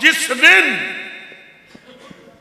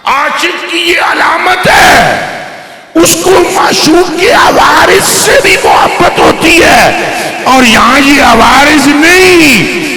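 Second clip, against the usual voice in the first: full-force preaching; 3 semitones higher, 6 dB louder, slower than usual. Acted. Preacher tells the congregation of a lover's devotion, full-force preaching. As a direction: proclaim it at full volume to a congregation.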